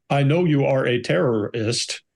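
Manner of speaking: slow, stilted delivery, word by word; imitating bad acting. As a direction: mimic a wooden interrogator who cannot act. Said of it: "you can hear 'em talking that, that way".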